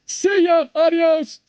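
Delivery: singsong send-off; mocking farewell. Real, not acted. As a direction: wave them off with a sneer.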